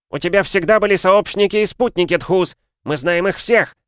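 high, loud falsetto; shrill falsetto accusation; falsetto shout. Acted. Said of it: "высоким фальцетом вскричал".

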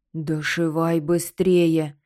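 slow loud alarmed skull voice; jaw-clattering urgent order; anxious skull. Acted. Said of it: "клацал зубами от волнения". (взволнованный череп)

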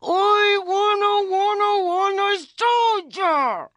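put-on very high, loud bawling child; screaming toddler tantrum. A narrator's reading. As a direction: wail it like a furious small boy. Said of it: "his screaming mouth".

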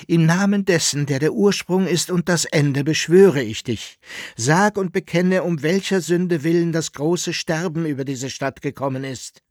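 loud, high, solemn adjuration; calling out. A narrator's reading. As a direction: cry it out as a solemn command.

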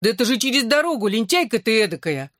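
put-on fast, high exasperated girl's shout; little girl voice. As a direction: blurt it out in a girl's own voice. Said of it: "воскликнула собственным голосом". (голосом маленькой девочки)

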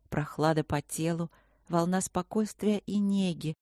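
plain reading voice

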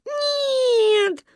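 high tearful wail; crying child. A narrator's reading. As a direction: bawl it like a little girl. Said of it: "плакала Маня". (плача, тонким голосом)

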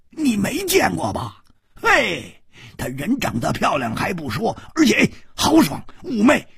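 boisterous Hu Tiehua. A narrator's reading. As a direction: rib his friend with gusto. Hu Tiehua teasing his friend.

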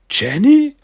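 high, loud startled "Jenny?"; surprised recognition. Acted. Silas recognizing Jenny after her punch, surprised high yelp. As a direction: blurt her name as it dawns on him.

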